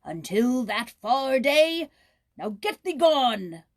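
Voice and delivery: stern angry king; banishing king. Acted. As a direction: cast him out. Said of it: "he swore with a mighty oath".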